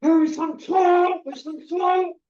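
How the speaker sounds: high, slow, strained paranoid voice; paranoid mutter. Retold or imitated. Ex-addict retelling his paranoia on walks, high and strained. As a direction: act out the panic, drawn out.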